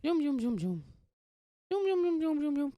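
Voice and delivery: high chanted zoom noises; playful radio jingle; zooming intro chant. Pretend. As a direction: fire off zooms like a race car. Intro sound effect chant.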